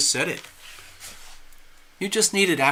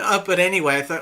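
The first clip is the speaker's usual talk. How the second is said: normal talking voice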